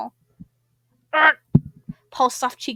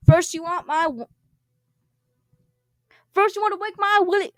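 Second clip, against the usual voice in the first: angry Paul; 3 semitones higher, 6 dB louder than usual. Acted. Paul voiced arguing back.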